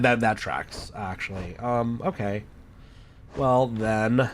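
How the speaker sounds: normal talking voice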